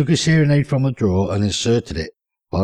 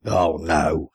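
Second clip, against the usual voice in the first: ordinary delivery, nothing marked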